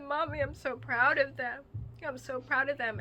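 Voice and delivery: crying through the memory of pride; tearful. Real, not acted. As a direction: voice breaking high with tears. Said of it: "I'm gonna probably start crying right now".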